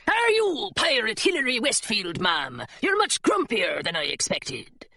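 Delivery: blunt pirate mate sizing someone up; drawling pirate with falling tune; pirate deckhand. Acted. Pirate mate speaks in story.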